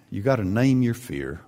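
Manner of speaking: ordinary talk, nothing marked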